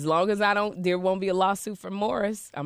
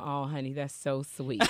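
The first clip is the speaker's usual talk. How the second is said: sugary sweet; 6 semitones lower, 7 dB quieter than usual. Retold or imitated. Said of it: "My face is going".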